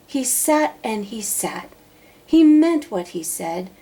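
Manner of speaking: ordinary delivery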